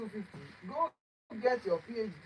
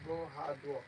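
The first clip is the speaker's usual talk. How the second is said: low, slow, laid-back Gen Z drawl; ironic zoomer; 7 semitones lower, 8 dB quieter than usual. Pretend. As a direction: talk down low like a chill Gen Z kid. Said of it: "let me talk like a Gen Z".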